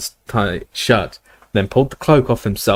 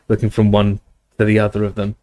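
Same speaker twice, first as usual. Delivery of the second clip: ordinary delivery, nothing marked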